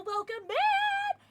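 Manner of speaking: high, slow, drawn-out greeting; sing-song welcome. Real, not acted. Streamer greeting a new follower in a long sing-song. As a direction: stretch the welcome out, beaming.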